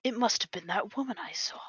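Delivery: breathy whispered aside. Acted. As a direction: whisper it to yourself. Muttered suspicion aside.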